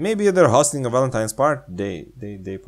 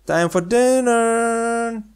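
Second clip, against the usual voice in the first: high, very slow call; 13 semitones higher, much slower than usual. Pretend. Grandma calling the player to dinner, high and slow. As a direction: call them in slowly.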